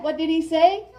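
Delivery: usual speaking voice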